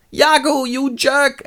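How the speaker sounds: high, loud dying reproach; dying cry. Acted. Betrayed Rodrigo curses Iago as he dies, high mock wail. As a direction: wail it out, hammed up.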